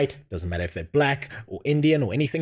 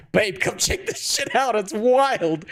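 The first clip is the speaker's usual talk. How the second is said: excited; 9 semitones higher than usual. Retold or imitated. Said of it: "I'd get my wife and be like".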